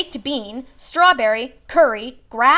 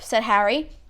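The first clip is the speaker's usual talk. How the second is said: usual speaking voice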